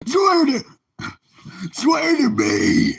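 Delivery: loud, strained vigilante shout; high, loud, smoke-wrecked hero impression. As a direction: shout it like a chain-smoking superhero.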